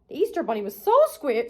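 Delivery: ordinary delivery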